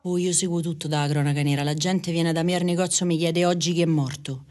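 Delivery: usual speaking voice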